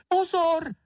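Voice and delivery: high, urgent cry of alarm; shouted warning. A narrator's reading. Miss Ferka warns Mumínek of danger behind him, crying out. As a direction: shriek it out very high. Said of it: "vykřikla".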